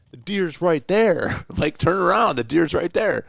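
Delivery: slow, high call across the woods. Retold or imitated. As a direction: call it out slowly to men far away. Calling to hunters a hundred yards off.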